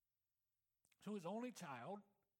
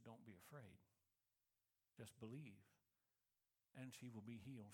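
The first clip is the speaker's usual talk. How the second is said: gentle reassurance; 8 semitones lower, 7 dB quieter than usual. Acted. Jesus repeating his comfort to Jairus, soft and reassuring. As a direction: soothe him quietly.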